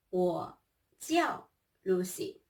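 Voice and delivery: ordinary talk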